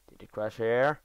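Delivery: usual speaking voice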